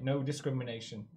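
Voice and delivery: ordinary talk, nothing marked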